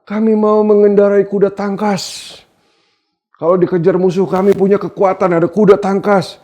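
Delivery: high, loud, confident claim; preacher declaiming their boast; boastful people's voice. Acted. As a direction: play the people bragging of their strength. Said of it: "Katamu pula".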